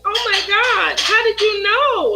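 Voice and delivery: high-pitched retold amazement; astonished disbelief. Mother found out by her son, high astonished voice. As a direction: replay being caught out, pitch jumping up.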